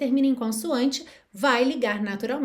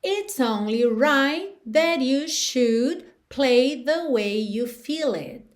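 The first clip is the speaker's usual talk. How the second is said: slow demo; 2 semitones higher, much slower than usual. Shown as a demonstration. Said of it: "teacher demonstrates song line slowly".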